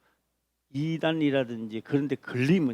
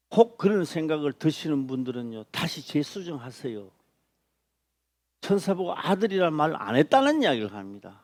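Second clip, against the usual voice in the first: normal talking voice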